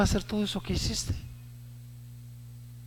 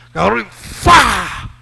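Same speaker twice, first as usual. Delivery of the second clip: loud, higher burst; excited climax; 6 semitones higher, 12 dB louder, slower than usual. Real, not acted. Preacher excitedly telling David's throw. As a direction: hit it like the stone flying.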